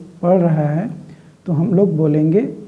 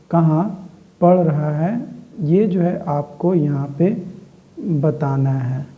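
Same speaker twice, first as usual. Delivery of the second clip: ordinary talk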